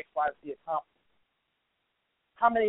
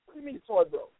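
ordinary talk, nothing marked

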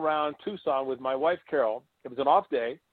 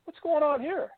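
much higher, startled question; a catcher baffled by the bullpen call; astonished; 12 semitones higher than usual. Retold or imitated. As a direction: blurt it out in shock. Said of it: "I went".

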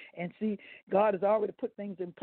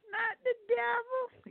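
emphatic high cry; 14 semitones higher, slower than usual. Real, not acted. Preacher correcting the congregation.

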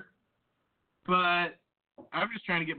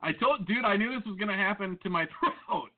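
usual speaking voice

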